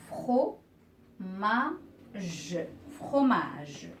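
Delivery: fromage as "fro... ma... ge", then whole; split into syllables. Shown as a demonstration. Teacher separating words into syllables for learners, syllable by syllable. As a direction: break fromage into spaced syllables, then join it. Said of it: "je vais séparer ces mots en syllabes".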